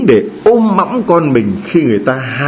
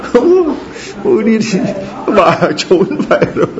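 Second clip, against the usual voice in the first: laughing mock wail; 9 semitones higher than usual.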